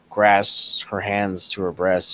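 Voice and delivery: ordinary delivery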